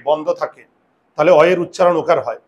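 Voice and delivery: ordinary talk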